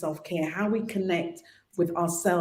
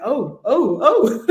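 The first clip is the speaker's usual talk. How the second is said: rising laughing oh, oh, oh, ending higher and louder; embarrassed surprise; 3 semitones higher, 7 dB louder, slower than usual. Retold or imitated. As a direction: caught out, laughing at yourself.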